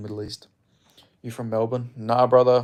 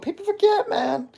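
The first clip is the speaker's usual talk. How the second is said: much higher, squeaky put-on voice; mock hype voice; 3 dB louder than usual. Streamer hypes Parker Porter to chat in a squeaky voice. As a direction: squeal it like a joking hype man.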